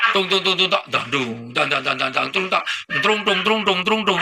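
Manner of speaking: sung drum beats; hammed-up drum sounds; mock music beats. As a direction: tung tung like a band. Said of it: "ngimam diiringi musik".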